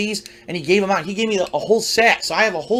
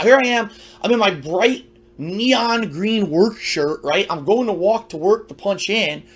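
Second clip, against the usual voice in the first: ordinary delivery, nothing marked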